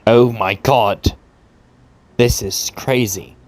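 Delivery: ordinary talk, nothing marked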